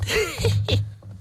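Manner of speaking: high airy cackle; breathy demon cackle. Acted. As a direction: laugh smugly under your breath. Dämon lacht über Heinrich. (hohes, gehauchtes Kichern des Dämons)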